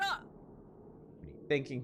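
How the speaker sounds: soft, slow, breathy hush; almost whispered, slow. Acted. Rin facing Yuta, hushed and slow. Rin's line, voice dropped.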